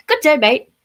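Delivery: high, loud mock Australian greeting; a hammed-up Australian good day. Greeting friend in Aussie style.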